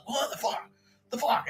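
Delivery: high, breathy mock disbelief; mock shocked. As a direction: gasp it out in shock. Retold shocked reaction.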